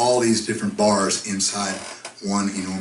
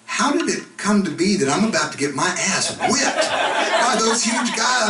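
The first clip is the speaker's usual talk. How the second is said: higher, rising panicked thought; mock panic; 10 semitones higher than usual. Comic voicing his own panic as bouncers approach. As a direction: squeak it out like you're about to get hit. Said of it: "I'm thinking".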